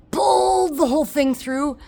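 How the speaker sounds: strained, pained telling; pained, rising. Real, not acted. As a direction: relive the burning pain, voice climbing. Reliving painful stitches.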